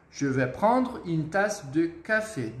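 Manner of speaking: slow, careful French repetition; deliberate teacher's demonstration; slow model sentence. Shown as a demonstration. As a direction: let each word land for learners.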